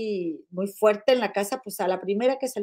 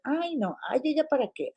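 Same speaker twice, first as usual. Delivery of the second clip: mock dismissive woman; 5 semitones higher, 6 dB quieter than usual. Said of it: "pero luego están".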